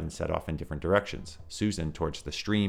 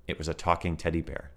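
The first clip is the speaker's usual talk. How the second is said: ordinary delivery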